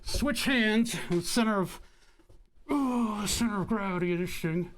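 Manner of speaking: talking around a marker held in teeth; pen in teeth. Real, not acted. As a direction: speak with a pen clamped in the mouth. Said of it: "hold the marker in my teeth".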